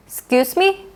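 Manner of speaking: "Excuse me" as a high rising "'scuse me"; informal, questioning "'scuse me" for "Excuse me"; casual short form. Shown as a demonstration. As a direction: drop the first vowel of "Excuse me" and lift it high. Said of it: "This is a more casual version".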